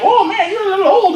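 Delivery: put-on high, quick old lady. Old lady to teenage trick-or-treaters, high doubtful voice. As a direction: put on a high-pitched older woman.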